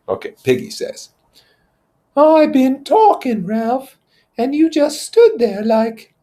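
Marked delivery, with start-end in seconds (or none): 2.2-6.1 put-on high, loud, slow indignant boy; Piggy's voice. Piggy scolding Ralph for standing silent. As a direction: complain at being ignored.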